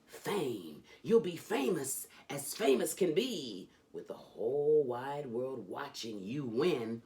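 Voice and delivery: lively, sing-song reading of a rhyming picture book; animated children's book read-aloud; storybook reading. Acted. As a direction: read it like a bedtime story for a kid. Reads Dr. Seuss aloud.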